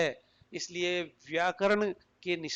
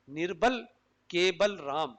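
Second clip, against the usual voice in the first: निर्बल, pause, then cable राम; cable राम grouped as a name, falling at the end; space after निर्बल. Shown as a demonstration. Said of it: "इस तरह से space देने से".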